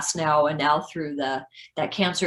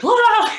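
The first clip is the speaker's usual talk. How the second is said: high, loud mock scream of panic; mock panicked scream, much higher and louder; 10 dB louder than usual. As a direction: shriek it as you bolt down the hall. Running out, slamming into walls.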